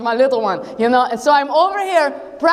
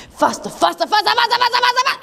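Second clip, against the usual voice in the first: speeding up excitement; 8 semitones higher, much faster than usual. Acted. Swinging the slingshot around.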